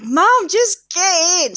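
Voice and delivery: high, loud, drawn-out nagging; impatient kids. Retold or imitated. Kids push hesitating mum into the sea, impatient call. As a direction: nag like kids fed up with mum.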